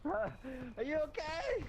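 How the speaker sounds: high, slow worried question; high slow concern. Acted. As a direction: ask gently in a high voice. Asking hurt friend.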